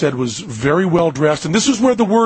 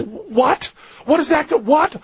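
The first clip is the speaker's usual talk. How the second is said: high, baffled retort; 9 semitones higher, slower than usual. Retold or imitated. Baffled by the stranger's challenge, high-voiced. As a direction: pitch the bafflement right up. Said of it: "Stop with the high voice".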